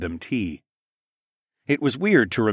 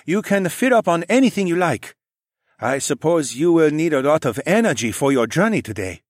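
put-on higher, lightly accented man; Sun He voice; 7 semitones higher, 4 dB louder than usual. A narrator's reading. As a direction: give him a polite, formal lilt. Sun He character voice.

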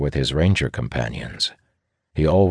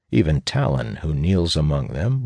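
ordinary delivery

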